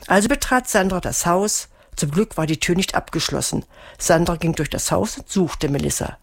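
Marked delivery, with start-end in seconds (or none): none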